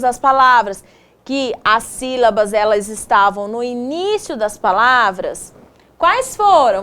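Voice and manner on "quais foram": ordinary delivery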